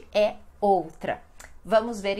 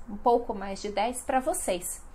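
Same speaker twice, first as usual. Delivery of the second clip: ordinary talk, nothing marked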